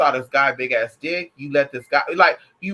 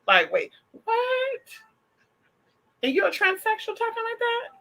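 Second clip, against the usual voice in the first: high, stunned wait what; jaw-dropped disbelief at transsexuals talking like that; shocked disbelief. Real, not acted. Vlogger asks her viewers in stunned, high-pitched disbelief. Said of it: "shocked disbelief".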